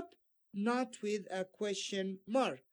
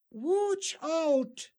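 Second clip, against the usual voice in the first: higher and louder, urgent; warning shout; 6 semitones higher, 5 dB louder than usual. Shown as a demonstration. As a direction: call out a warning. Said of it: "an exclamation mark at the end".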